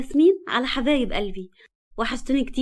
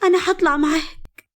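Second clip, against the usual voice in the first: feigned crying; 7 semitones higher, 3 dB louder than usual. A narrator's reading. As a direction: plead as if pretending to weep. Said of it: "وهي بتمثل البكا".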